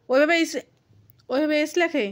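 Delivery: ordinary delivery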